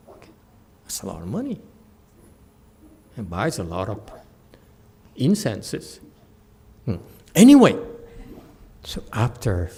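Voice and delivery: ordinary talk, nothing marked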